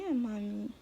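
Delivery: soft, lightly voiced baby line; prompting baby's reply. Mom speaking for her baby, a soft little voice. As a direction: mom coaxes her baby to answer, softly.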